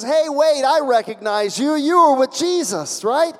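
high, loud, insistent recognition; slave girl's accusation. Acted. Acting slave girl accusing Peter.

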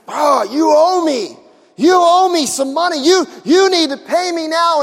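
loud, high, slowed threatening demand; the forgiven servant bullying his fellow servant, loud and high; harsh demand. Acted. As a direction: lean on him for the money.

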